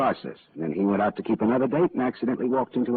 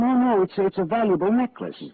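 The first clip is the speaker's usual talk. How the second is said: urgent insistence; 13 semitones higher, 5 dB louder than usual. Acted. Insists to the police lieutenant.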